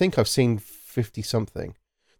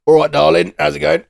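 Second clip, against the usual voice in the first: cheeky London lad; 6 semitones higher, 9 dB louder than usual. Retold or imitated. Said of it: "And then it's".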